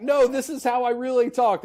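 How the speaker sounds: loud, high-pitched retold protest; mock protest. Actor insisting to doubting New Zealanders, mock protest.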